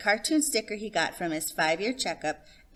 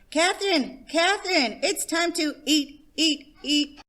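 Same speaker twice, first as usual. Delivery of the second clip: high loud yell calling across the room; 10 semitones higher, 6 dB louder than usual. A narrator's reading. Johnny on his chair yelling to Catherine, loud. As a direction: call her over at the top of your voice.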